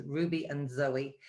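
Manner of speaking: normal talking voice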